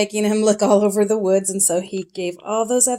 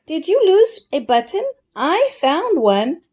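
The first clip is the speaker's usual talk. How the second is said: little sparrow voice; 8 semitones higher than usual. Acted. Sparrow offering the found button, high little voice. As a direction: play a tiny helpful sparrow.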